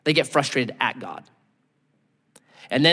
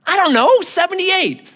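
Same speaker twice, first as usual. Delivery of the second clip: high, loud, evasive answer; sheepish driver; 6 dB louder than usual. Retold or imitated. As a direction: shrug it off to the cop.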